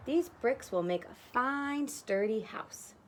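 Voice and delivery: slow, measured pitch; slow salesman. Acted. Brick man recommending bricks, slow and deliberate. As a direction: sell the bricks slowly and firmly.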